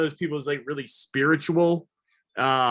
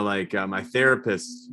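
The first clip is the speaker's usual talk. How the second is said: normal talking voice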